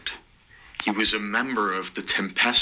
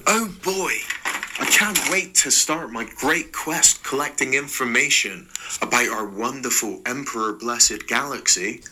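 high, eager, giddy voice; Mark crying out; 4 semitones higher than usual. Acted. Mark cheering about his great quest, giddy and high. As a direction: gush it like an overexcited fool. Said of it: "Mark cried".

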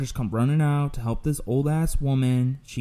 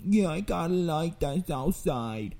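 put-on old lady voice; 5 semitones higher, 3 dB quieter than usual. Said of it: "And she's like".

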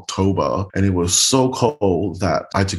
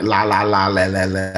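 Singlish la. Shown as a demonstration. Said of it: "we speak like".

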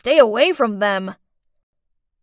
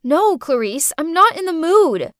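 raised voice, louder and higher; irritated shout; 5 semitones higher, 6 dB louder than usual. A narrator's reading. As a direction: snap back at a pestering child. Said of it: "I shouted".

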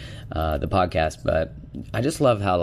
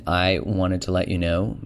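ordinary talk

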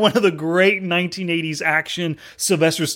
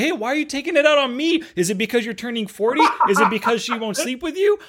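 Jefe; 8 semitones higher than usual. Retold or imitated. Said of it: "Jefe turns back and says".

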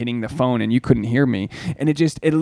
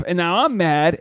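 ordinary talk, nothing marked

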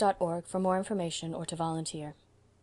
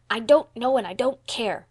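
loud, raised defiant retort; fierce; 4 semitones higher, 8 dB louder than usual. A narrator's reading. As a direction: turn fiercely on your accuser. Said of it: "turned fiercely".

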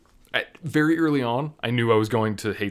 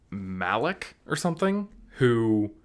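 ordinary talk, nothing marked

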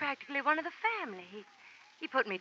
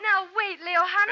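alarmed pleading; 6 semitones higher, 5 dB louder than usual. Acted. Girl caught kissing stranger by Leo.

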